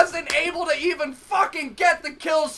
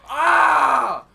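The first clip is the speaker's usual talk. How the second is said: ordinary talk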